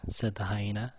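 ordinary delivery

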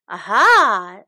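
loud gotcha exclamation; crying out. Acted. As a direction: catch him out, aha. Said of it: "cried Shen".

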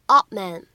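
"odd man" with the d held unreleased; incomplete plosion. Shown as a demonstration. Teacher showing learners "odd man" with an unreleased d. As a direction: stop the d of "odd", straight into "man". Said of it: "可以有一个不完全爆破的处理".

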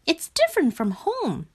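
high little frog observing; high-pitched storybook character voice; wondering frog voice. A narrator's reading. Frog remarks on the new place.

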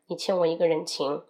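ordinary talk

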